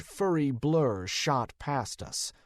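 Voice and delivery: ordinary delivery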